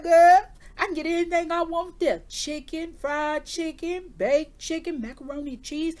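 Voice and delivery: high, loud, relishing each dish; excited grandma. Retold or imitated. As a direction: imitate grandma eagerly listing her favorites. Voicing grandma's eager food list.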